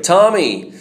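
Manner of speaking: high, loud Texan call of a name; shouted Texan accent. Retold or imitated. Texan friend shouts a greeting across the lounge, Texan accent. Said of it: "Jason came in and shouted".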